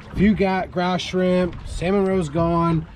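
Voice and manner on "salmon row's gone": ordinary delivery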